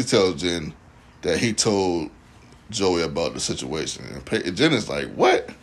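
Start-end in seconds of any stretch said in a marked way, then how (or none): 5.1-5.5 high, sharp mock what; shocked what. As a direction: snap it out in shock. Jen's shocked reply.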